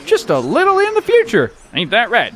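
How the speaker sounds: ordinary talk, nothing marked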